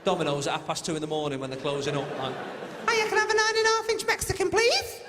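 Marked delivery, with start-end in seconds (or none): 2.9-5.0 very high squeaky phone order; absurdly high, squeaked polite request; squeaky helium voice. Acted. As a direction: order food as if full of helium. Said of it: "we got squeaky voices".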